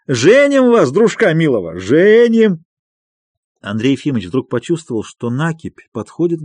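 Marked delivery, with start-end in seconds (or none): 0.1-2.6 high, loud singsong tease; sly jovial teasing. A narrator's reading. Friend teasing the doctor about marrying him off, slyly jovial. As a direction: crow it with a playful wink. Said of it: "лукаво подмигнул глазом".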